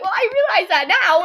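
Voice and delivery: high, loud imitation of a defensive mom; mock flustered mom. Daughter imitating her mom admitting the slip, mock flustered. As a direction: exaggerate mom's belated realization. Acts out mom's belated realization.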